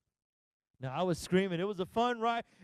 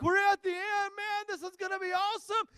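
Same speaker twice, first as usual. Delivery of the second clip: buzzing with excitement, pitched up; excited; 9 semitones higher than usual. Retold or imitated. A father to his family, excited they finally reached the end. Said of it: "I'm like".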